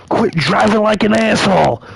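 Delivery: high, loud cop's yell. Retold or imitated. Retelling a cop telling off the friend, yelling. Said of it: "then just yells at him".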